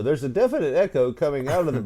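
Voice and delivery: normal talking voice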